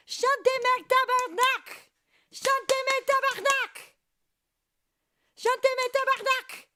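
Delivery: very high, loud mock cursing; playful yelled command. Streamer showing off a phrase learned from Julienne, playful yell. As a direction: yell the order like a rowdy crowd-rouser.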